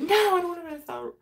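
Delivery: high, whiny protest. Retold or imitated. Whines about missing out on her daughter's play. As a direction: whines like a left-out kid. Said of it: "I'm like".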